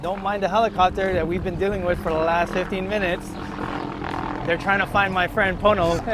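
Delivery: projecting over a helicopter overhead; yelling over helicopter. Real, not acted. Talking over a loud helicopter.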